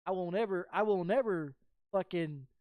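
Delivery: usual speaking voice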